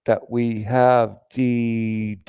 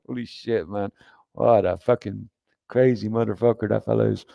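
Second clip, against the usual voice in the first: usual speaking voice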